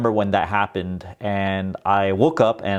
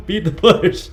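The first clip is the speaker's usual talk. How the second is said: laughing, much higher voice; amused self-mockery; 9 semitones higher, 5 dB louder than usual. Real, not acted. Creator mocking his old channel intro, laughing. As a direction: say it through a laugh, mocking yourself.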